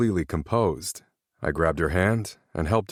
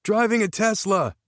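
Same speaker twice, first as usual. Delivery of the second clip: excited shout. A narrator's reading. Shouted charades guess.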